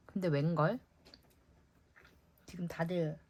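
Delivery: ordinary talk, nothing marked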